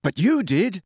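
indignant retort; angry. A narrator's reading. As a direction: throw it back as an accusation. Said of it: "said Harry angrily".

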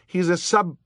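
high, loud indignant protest. A narrator's reading. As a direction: start to correct them hotly, then cut off. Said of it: "Violet said indignantly".

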